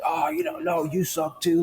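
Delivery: high, childish mock comeback, lightly done; mock name-calling retort. Imagining trading insults with a name-caller, mock petty retort. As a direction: toss back a petty insult in a put-on voice.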